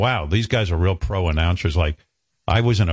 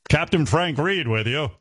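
announcer voice; 5 semitones higher than usual. Retold or imitated. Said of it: "a grown man calling himself Captain Frank".

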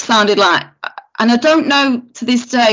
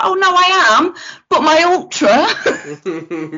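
tearful plea; 7 semitones higher, slower than usual. Retold or imitated. Crying to doctor, stretched out.

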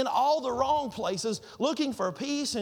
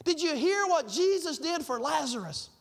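excited; 6 semitones higher, slower than usual. Retold or imitated. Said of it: "still kind of floating through the air".

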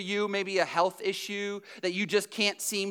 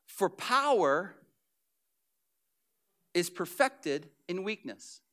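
slow solemn reading; 3 semitones lower, 4 dB quieter, slower than usual. Acted. Scripture read slow.

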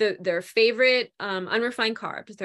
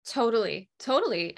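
normal talking voice

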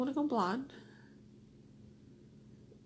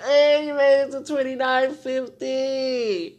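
slow, loud, higher mock cheer; drawn-out cheer; 4 semitones higher, 9 dB louder, slower than usual. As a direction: stretch it out like a hearty shout-out. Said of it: "Be like".

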